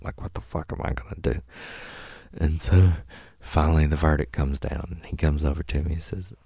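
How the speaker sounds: ordinary talk, nothing marked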